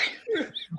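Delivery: high breathy giggle; laughing. Real, not acted. Telling the raccoon prank story, high giggling.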